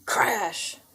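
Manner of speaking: ordinary delivery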